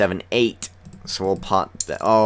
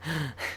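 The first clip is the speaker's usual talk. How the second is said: short laugh; 8 semitones higher, 11 dB quieter than usual. Real, not acted. Laughing at friend sitting out.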